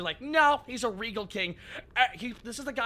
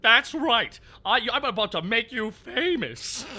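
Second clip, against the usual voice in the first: Bluster voice; 3 semitones higher, 7 dB louder than usual. Retold or imitated.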